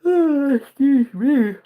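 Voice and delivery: slow, high words through a yawn. Real, not acted. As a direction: excuse yourself mid-yawn. Another yawn, excuses himself.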